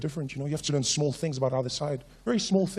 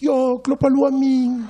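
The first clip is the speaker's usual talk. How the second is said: friend's voice; 8 semitones higher, 5 dB louder, much slower than usual. Retold or imitated. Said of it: "Snowflake was like".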